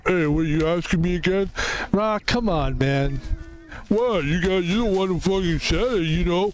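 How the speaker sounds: lightly slurred Rocky Balboa voice with lilting pitch; Rocky impression. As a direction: play a pally Rocky, protesting. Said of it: "we have to do your fucking accent".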